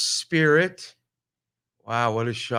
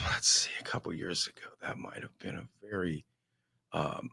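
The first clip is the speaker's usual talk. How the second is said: whispered aside; 6 semitones lower, 11 dB quieter than usual. Real, not acted. Confessing aside to the listener.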